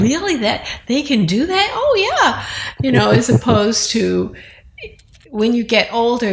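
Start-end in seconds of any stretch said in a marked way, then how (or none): 0.0-2.5 put-on higher, amazed little child asking really; wide-eyed toddler. Grandmother voicing her toddler grandson's amazed really, higher. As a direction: play a toddler whose eyes go big.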